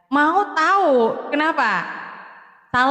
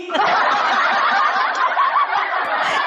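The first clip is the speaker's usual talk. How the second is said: very high shrieking laugh. Real, not acted. Laughing at viewer question.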